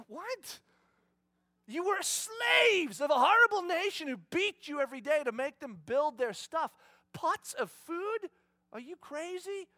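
high, loud, disbelieving rebuke; exasperated rebuke of ungrateful people; incredulous outburst. Retold or imitated. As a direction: scold them in disbelief. Indignant retort as God.

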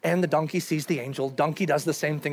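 ordinary talk

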